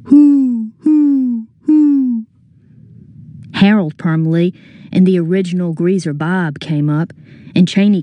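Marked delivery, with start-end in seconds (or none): 0.0-2.3 high, hooting owl call; owl hoots. Acted. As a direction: hoot like a mocking owl. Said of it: "made noises like an owl".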